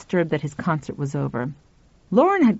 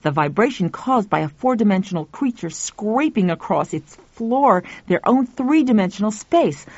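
plain reading voice

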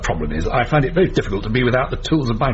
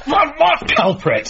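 alarmed; slower than usual. Acted. Threatened by intruder.

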